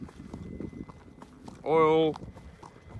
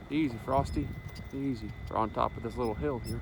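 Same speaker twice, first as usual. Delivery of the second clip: soothing the horse; 5 semitones lower, 10 dB quieter than usual. Real, not acted. Calming the horse while riding.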